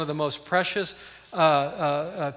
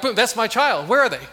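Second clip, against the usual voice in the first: higher, louder, laughing mom snapping to attention; alert mom; 7 semitones higher, 8 dB louder than usual. Retold or imitated. Mom hearing her child cry in a crowd, high and loud with a laugh. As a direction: play a mom who instantly knows her own child's cry. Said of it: "moms are like".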